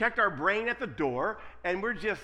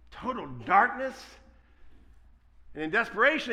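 ordinary delivery

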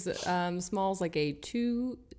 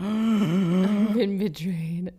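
slow, louder hammed-up despair; mock betrayal; 5 dB louder, much slower than usual. Cohost mocking her realization, slow melodrama. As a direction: drag it out like a tragic hero.

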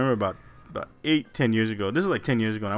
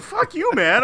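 mock retort; slower than usual. Retelling his answer to the salesman, a high mock retort. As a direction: fire it back, half joking. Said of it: "I'm like".

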